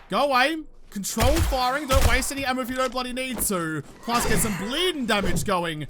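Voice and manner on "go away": louder higher go away; louder shooing. Real, not acted. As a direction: yell it to shoo it. Zombie approaching.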